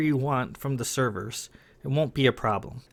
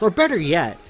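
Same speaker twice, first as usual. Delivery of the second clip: angry insult; 5 semitones higher, 4 dB louder than usual. Acted. Sarah insults Sam.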